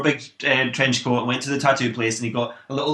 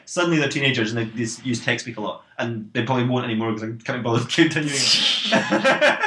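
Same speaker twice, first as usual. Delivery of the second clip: usual speaking voice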